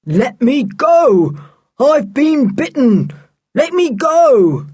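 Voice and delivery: high, loud, slow desperate pleas; muffled anguished cries from inside the curtains; anguished cry. A narrator's reading. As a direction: beg to be freed, trapped and hurting. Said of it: "An anguished voice came from".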